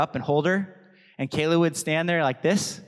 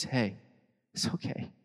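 soft, gentle reassurance; soft encouragement; 3 dB quieter than usual. Retold or imitated. Church gently encourages a fallen brother, softly. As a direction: comfort someone who fell. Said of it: "a place that says".

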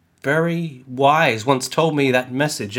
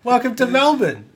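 a raised, hammed-up Aussie greeting; bright Australian tourist-guide welcome, pitched up; Australian accent; 7 semitones higher than usual. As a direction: lay on a cheery Australian twang, higher up.

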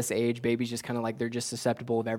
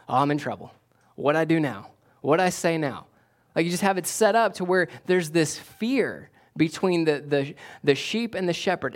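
fervent preaching; 4 semitones higher than usual. Acted. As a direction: drive the point home to the congregation. Preaching at full force.

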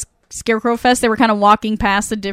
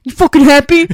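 sarcastic snap; 4 semitones higher, 9 dB louder than usual. Retold or imitated. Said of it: "And he's just like".

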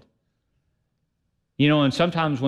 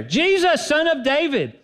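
much higher, ringing cry; raised voice; 12 semitones higher than usual. Shown as a demonstration. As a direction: lift your voice and cry out. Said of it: "that means raise your voice".

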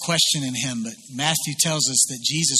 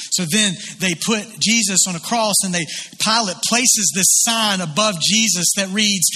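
ordinary delivery

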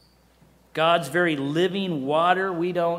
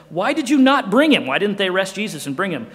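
indignant Pharisees; 4 semitones higher, 7 dB louder than usual. Retold or imitated. Pharisees demand of officers.

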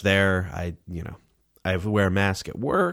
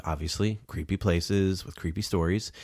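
normal talking voice

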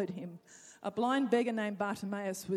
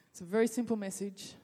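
ordinary talk, nothing marked

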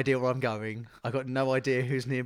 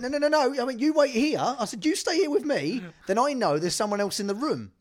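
high-pitched panicky pleading; panicked; 10 semitones higher, 4 dB louder than usual. Retold or imitated. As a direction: beg him to stay, rattled. Blindfolded, panicked plea to actor.

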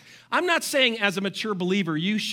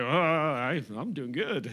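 smug complacent; 6 semitones lower, 4 dB quieter, slower than usual. Retold or imitated. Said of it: "who are feeling like".

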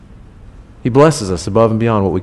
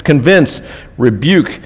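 usual speaking voice

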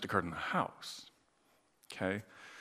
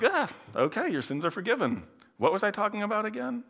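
lightly voiced offhand Jesus, higher pitched, brushing it off; offhand, distracted; 8 semitones higher, 3 dB quieter, faster than usual. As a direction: toss it away, already thinking of something else. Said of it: "Jesus is like".

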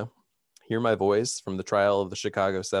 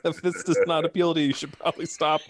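giggling through high, fast words; can't stop laughing while talking; 8 semitones higher, 5 dB louder, faster than usual. Real, not acted. Cracking up mid-announcement.

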